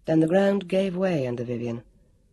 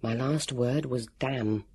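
slow, low, soft undertone; hushed, drawn-out private voice; inner thought; 4 semitones lower, 7 dB quieter, slower than usual. A narrator's reading. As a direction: think it slowly, playing dead. Heroine's thought, playing dead.

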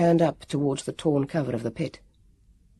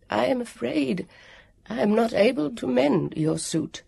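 an ancient guardian apologizing gently; apologetic warrior; 7 semitones higher than usual. A narrator's reading. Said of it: "the warrior said apologetically".

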